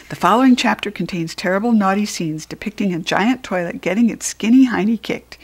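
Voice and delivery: usual speaking voice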